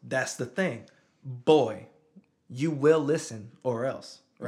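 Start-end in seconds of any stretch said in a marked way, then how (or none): 1.2-4.2 loud, firm retold warning; stern father. Dad recalls warning his son to listen, stern and loud. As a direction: lay down the law to a child.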